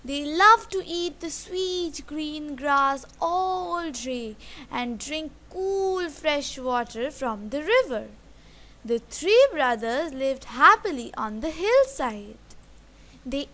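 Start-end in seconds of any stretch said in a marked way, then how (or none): none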